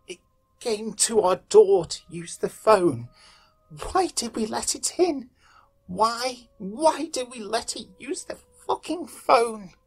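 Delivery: high, anguished sobbing lament; weeping. A narrator's reading. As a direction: wail it slowly through tears. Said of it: "He started to weep and mutter to himself".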